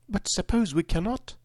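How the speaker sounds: put-on very high little-girl voice; Dorothy. A narrator's reading. Girl doubting the plan to her friends, high-pitched. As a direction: lift it right up into a girl's worried question. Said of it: "said the girl".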